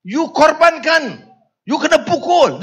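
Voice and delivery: imitated indignant scolding; angry Karpal Singh. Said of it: "Itu kata Karpal".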